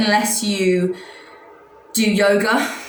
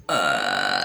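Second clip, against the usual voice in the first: low creaky croak; vocal fry; 4 dB quieter than usual. Shown as a demonstration. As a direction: croak out the lowest note. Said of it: "So it goes like this".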